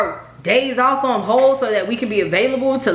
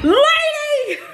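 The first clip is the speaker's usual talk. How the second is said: high exasperated squeal. Retold or imitated. Wife recalling her outburst at the realtor, high squeal. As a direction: shriek the word in disbelief. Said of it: "I'm like".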